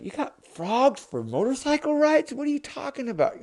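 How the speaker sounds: high-pitched disbelief; baffled questioning, speeding up; incredulous brother. Acted. Brother doubting the frog story, incredulous.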